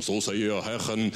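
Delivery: low, solemn mock-prophet voice; religious tone. Preacher mocking a churchy prophecy, low solemn intone. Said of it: "utan att lägga på en religiös ton".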